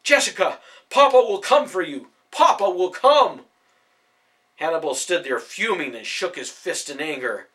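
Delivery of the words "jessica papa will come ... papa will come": loud, high call to the wagon; desperate calling. A narrator's reading. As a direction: promise her at the top of his voice. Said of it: "Hannibal called out".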